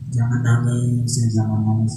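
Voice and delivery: put-on soothing a crying child. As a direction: comfort it like a small child. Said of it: "Kayak anak kecil gitu".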